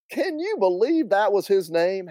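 high-pitched Southern woman in disbelief; gleefully incredulous aunt. Acted. Acted aunt marvelling at the name.

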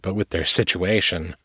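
ordinary delivery, nothing marked

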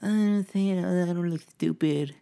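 high, put-on voice of a nervous beginner; mock self-conscious learner. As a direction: squeak it out like a learner faking confidence. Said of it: "you kinda".

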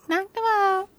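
put-on high, soft little kid echo. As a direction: parrot it like a small child. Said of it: "repeating everything you said in a little kid voice".

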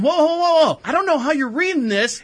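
retold startled objection; taken-aback protest. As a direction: back off in high-pitched protest. Retelling his protest to the commenter.

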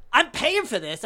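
high, loud mock outrage; indignant protest. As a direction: pull rank as the affronted big spender. Old man's indignant protest.

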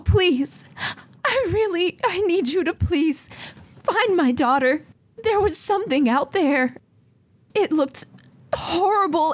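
high, pleading mother's voice, near tears; distraught mother. Acted. Frantic mother begs the Seekers to find her daughter, high and pleading. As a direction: beg like a frantic mother whose child is gone. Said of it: "And she says".

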